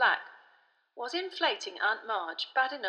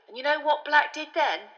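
plain reading voice

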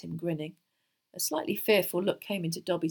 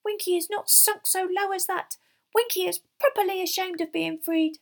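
high, indignant elf squeak; high-pitched, furious little house-elf; squeaky; 12 semitones higher, 6 dB louder than usual. A narrator's reading. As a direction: squeak it proudly as a tiny elf. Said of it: "she squeaked".

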